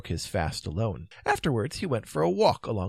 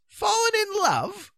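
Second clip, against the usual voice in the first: very high, drawn-out disbelief; slow, high astonished echo; incredulous question; 4 dB louder, slower than usual. A narrator's reading. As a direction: echo it slowly, pitched right up in amazement. Astonished king echoes the news.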